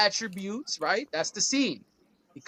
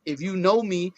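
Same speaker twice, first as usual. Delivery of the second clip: ordinary talk, nothing marked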